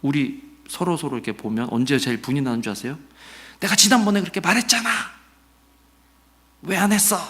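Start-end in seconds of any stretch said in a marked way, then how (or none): 3.6-7.2 quick, higher, mock nagging at someone; nagging reproach. A lightly voiced partner nagging about an undone task. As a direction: scold like an exasperated spouse, fast and light. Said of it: "막 그렇게 하면 뭐예요?".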